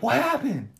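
a hint of panic; mock worried. Friend retelling the movie, a touch of alarm. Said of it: "Tucker's like".

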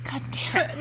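breathy whisper under the breath; whispered curse. Real, not acted. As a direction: whisper it to yourself. Muttered reaction to the story.

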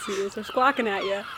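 ordinary talk, nothing marked

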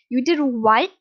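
loud outraged question; angry disbelief. A narrator's reading. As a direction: demand it, incensed and loud. Furious father hears of attack.

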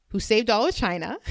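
ordinary delivery

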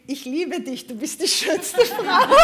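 laut und langsam, vor Lachen kaum haltend; lachend vorgelesen. Real, not acted. Reads cook's love message. (prustend vor Lachen, lauter vorgelesen)

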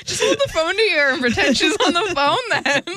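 high, laughing through the words; half-laughing, high and loud. Real, not acted. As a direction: giggle it out as you mock. Laughing through mocking advice.

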